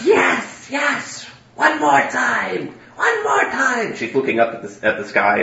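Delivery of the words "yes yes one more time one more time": high, strained yell held back in volume; mad scientist yelling. Acted. Game master voicing her calling to the sky, restrained yelling. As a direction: stretch it out slowly, high-pitched and exultant. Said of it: "as she's yelling".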